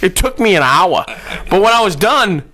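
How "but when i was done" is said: ordinary talk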